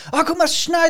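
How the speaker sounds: put-on high, bright squeal of a delighted child; childlike excitement. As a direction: gasp at the snow like a little kid. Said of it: "wie so ein kleines Kind".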